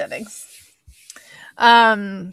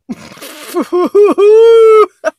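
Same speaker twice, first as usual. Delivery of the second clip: loud burst of laughter; 13 semitones higher, 15 dB louder than usual. Real, not acted. Reacting to the friend's line.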